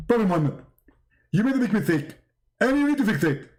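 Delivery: mock-scolding preacher, high then falling phrases; Zakir Naik as Jibreel. Angel tells Muhammad of his mistake, hammed-up scolding preacher. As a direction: lecture him in a lisping preacher impression. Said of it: "You look like Zakir Naik".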